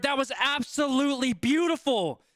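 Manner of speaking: ordinary delivery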